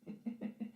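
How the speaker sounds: breathy laugh. Real, not acted. Breathy laugh after a swear.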